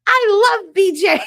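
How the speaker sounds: put-on high, loud and slow, a little girl gushing; child's squeal. Imitating her child self gushing to grandma, high and loud. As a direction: squeal it like a small child in love with a toy. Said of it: "You'd hear me going".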